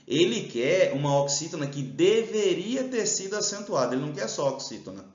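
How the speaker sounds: ordinary talk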